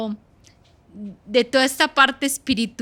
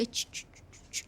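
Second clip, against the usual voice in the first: sharp, breathy shushing sounds; 18 dB quieter than usual. Retold or imitated. Mother shushing her noisy children from bed, sharp shushing. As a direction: hush the children. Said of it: "y les hacía así".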